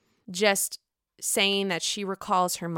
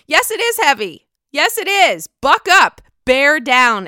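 loud, higher scolding taunt; buck up; 6 semitones higher, 9 dB louder than usual. Real, not acted. Recapper jeers at the whining cast member, loud and high. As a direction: tell him off loudly to toughen up. Mocking the whiner, telling him to toughen up.

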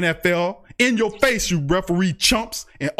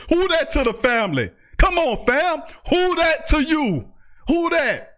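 higher, excited shouted chant; fired-up rally cry; 6 semitones higher than usual. Real, not acted. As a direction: pump up the fans with a shouted call. Rallying the Saints fan chat.

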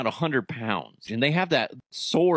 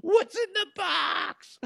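high, drawn-out anguished movie wail; hammed-up dramatic cry; mock movie soundbite; slower than usual. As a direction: imitate a desperate film star, stretching each word. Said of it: "that soundbite from that movie".

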